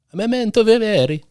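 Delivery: hammed-up Italian, high and loud; Italian accent. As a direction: belt it like a big Italian. Repeats phrase in big Italian voice.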